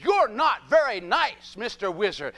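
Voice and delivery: loud, slow, emphatic telling-off; Dorothy's scolding. Acted. Dorothy standing up to the Wizard, boldly scolding. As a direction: scold him word by word, loud then dropping. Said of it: "Dorothy said".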